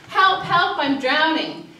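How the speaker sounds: a third drowning person yelling from the river; mock cry for help. Telling a parable, imitates the third drowning person's mock yell.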